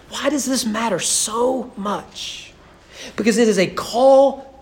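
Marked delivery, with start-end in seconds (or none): none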